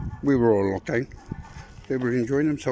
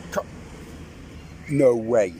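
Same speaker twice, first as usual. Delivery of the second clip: shocked; slower than usual. Real, not acted. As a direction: blurt it out in shock. Shocked at lift.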